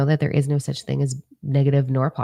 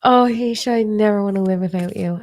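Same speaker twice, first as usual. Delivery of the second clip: tearful; 5 semitones higher than usual. Real, not acted. Emotional response to comment.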